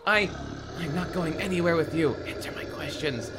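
high-pitched dramatic refusal; a hammed-up touch of panic; heroine protesting. Heroine refuses boyfriend in story.

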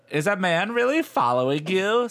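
put-on high, bright girlish friend voice; Stacy's voice. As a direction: lift it up into a nosy friend. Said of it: "Stacy asked".

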